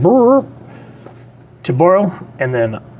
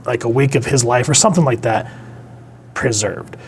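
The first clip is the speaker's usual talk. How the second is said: usual speaking voice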